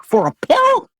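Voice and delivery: squeaky, incredulous rise on pill; shocked disbelief. Retold or imitated. Hearing a pill costs fifty bucks, shocked high disbelief. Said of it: "It's like".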